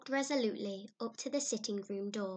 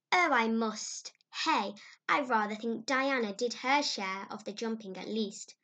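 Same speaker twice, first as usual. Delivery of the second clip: put-on a prim old lady scoffing; prickly old aunt, indignant; sarcastic stern old woman; 6 dB louder than usual. Miss Barry retorting sarcastically.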